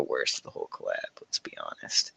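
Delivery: low confiding whisper; whispered aside. Real, not acted. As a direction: drop to a whisper for the jab. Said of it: "whispered aside".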